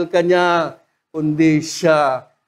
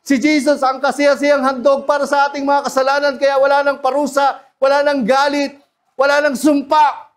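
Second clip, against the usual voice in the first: raised, driving preacher's build; preaching; 7 semitones higher than usual. Acted. Preacher to the congregation, building with force. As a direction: drive it home, line after line.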